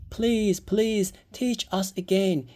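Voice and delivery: put-on high pleading child voice; begging children. A narrator's reading. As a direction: plead like eager kids.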